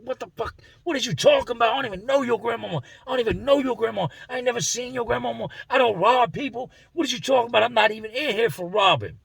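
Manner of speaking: high, fast, indignant disbelief; high-pitched bewildered denial; baffled protest. Retold or imitated. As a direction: plead total confusion. Baffled man denying robbery.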